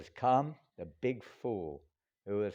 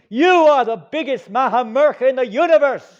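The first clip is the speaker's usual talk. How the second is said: high, loud shout of scorn; shouting at the king; 19 dB louder than usual. Acted. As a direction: yell it straight in his face. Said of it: "he shouted at him".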